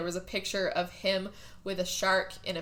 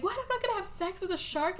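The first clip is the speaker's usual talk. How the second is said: high, quick, softer incredulous quote; mock disbelief; 11 semitones higher, 5 dB quieter, faster than usual. Frank refuses the shark plan, mock disbelief. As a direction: balk at it in a raised pitch.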